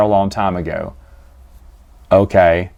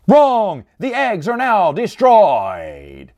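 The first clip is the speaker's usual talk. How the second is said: higher snap then slow announcement; mock chair verdict; 6 semitones higher, much slower than usual. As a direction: pronounce doom slowly. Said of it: "He's like".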